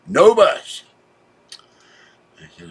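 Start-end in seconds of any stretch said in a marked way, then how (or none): 0.1-0.8 louder, higher cry of dismay; stranded at night with no bus, exasperated cry; exasperated outburst. Real, not acted. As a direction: let the disappointment burst out. Bus fails to come, exasperation.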